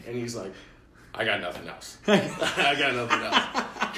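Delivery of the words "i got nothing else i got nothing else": retold helpless admission through laughter; laughing dad impression. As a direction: imitate a stumped dad while cracking up. Dad's helpless line, laughing.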